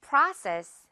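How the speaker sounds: Process with an open ah; model word for repeating. Shown as a demonstration. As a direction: say Process clearly for the class. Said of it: "듣고 따라해주세요".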